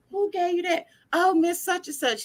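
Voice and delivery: lightly voiced back-and-forth; high mock question. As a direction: mom asks her son who gave him that, voiced high. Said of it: "And I'm like".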